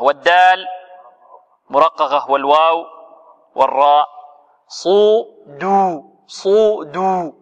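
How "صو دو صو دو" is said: very slow chanted صو دو, each syllable held; correct slow syllables. Shown as a demonstration. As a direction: split صو دو cleanly like a teacher. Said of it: "وليس صدو دو".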